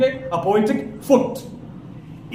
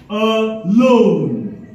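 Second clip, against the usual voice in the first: "alone" drawn out, weight on "lone"; second-syllable stress. Shown as a demonstration. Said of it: "stress on the second syllable".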